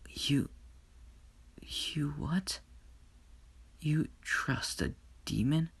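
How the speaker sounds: soft breathy disbelief, falling to a whisper; heartbroken, barely able to get it out; stunned whisper. Acted. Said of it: "his heart dropped".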